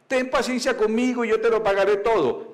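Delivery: pleading fellow servant. Acted. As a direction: beg harder, voice pushed up. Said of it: "lo mismo que él dijo al otro".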